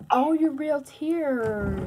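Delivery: put-on stretched-out sympathetic baby talk; mock amazed sympathy. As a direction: marvel tenderly at the tears. Dad soothes child in pretend play.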